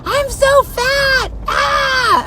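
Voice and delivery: high, slow frantic shriek. Retold or imitated. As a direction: shriek it in a panic. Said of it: "I was, like, going".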